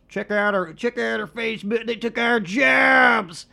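high put-on voice building to a drawn-out shout; comic mock yell. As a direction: ham it up into a loud, high, stretched yell. Comic character yell in podcast plug.

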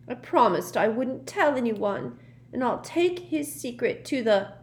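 put-on high, indignant girl's voice; huffy ghost girl. A narrator's reading. Myrtle guarding the boy's secret, huffy. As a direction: declare it with wounded dignity.

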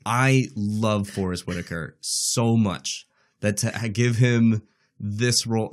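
ordinary talk

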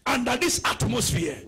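high, loud declaration over the congregation; fervent preaching prayer. Real, not acted. Preacher blessing the congregation's work, fervent shouted prayer.

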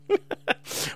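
high unvoiced giggle; breathy giggle. Real, not acted. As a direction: giggle under your breath. Laugh after joke.

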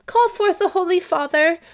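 high, loud summons; crying out. Acted. Olivia summoning the priest as witness, crying out. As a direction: call out urgently, pitched high. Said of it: "cried Olivia".

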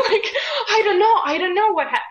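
high, loud, through real laughter; laughing. Real, not acted. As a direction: talk while cracking up at yourself. Laughing at her own childhood zeal.

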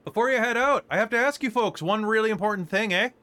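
high, cheery Canadian with eh; Canadian shopkeeper. Acted. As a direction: ask the big question eagerly. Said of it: "leans out and goes".

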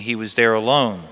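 ordinary delivery

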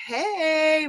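usual speaking voice

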